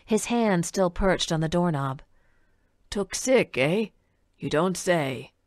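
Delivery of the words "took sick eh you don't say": a lightly voiced old man, half to himself; mild old man's wry murmur; old man musing. A narrator's reading. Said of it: "almost as if talking to himself".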